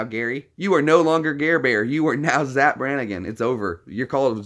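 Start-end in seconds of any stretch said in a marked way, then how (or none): none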